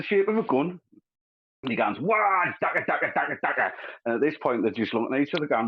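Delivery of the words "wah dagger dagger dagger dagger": high whooping "Wah!" then a chanted dagger; a mock orc charging in; a hint of a battle-crazed orc; orc war cry. Orc voiced in retold joke.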